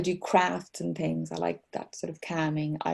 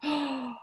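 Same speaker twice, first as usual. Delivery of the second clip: delighted gasp; 8 semitones higher, 5 dB quieter than usual. Real, not acted. Excited at mention of [name].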